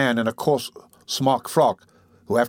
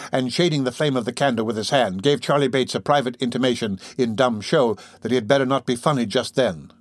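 ordinary delivery

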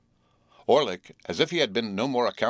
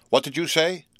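high, loud indignant cry; cried out; 8 semitones higher, 4 dB louder than usual. A narrator's reading. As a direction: cry it out in outrage. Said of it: "cried my sister".

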